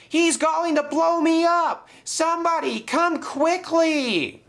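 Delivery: very high, loud panicked cry; frightened yelling. A narrator's reading. James yelling for rescue from the dynamite, panicked. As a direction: shriek for rescue in fear. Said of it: "he called".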